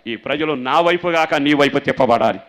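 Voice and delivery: loud shouted plea; preaching at full force. Acted. Pastor proclaiming the prayer to the congregation, shouting. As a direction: hammer the plea out loudly.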